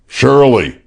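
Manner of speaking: hoarse voice. A narrator's reading. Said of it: "in a hoarse voice".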